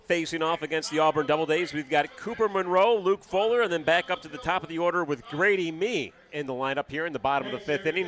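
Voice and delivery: normal talking voice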